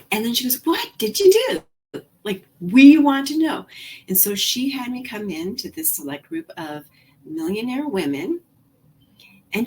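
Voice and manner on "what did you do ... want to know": eager question. Retold or imitated. Mentor eagerly asking her secret.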